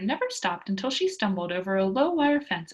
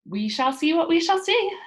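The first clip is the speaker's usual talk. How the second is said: put-on lively girl, a touch louder and higher; bright confident kid declaring it; Pippi's voice; 7 semitones higher, 7 dB louder than usual. A narrator's reading. Pippi cheerfully answering Tommy and Annika, lively girl voice.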